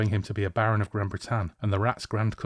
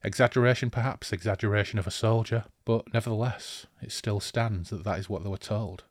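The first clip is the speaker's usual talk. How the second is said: ordinary talk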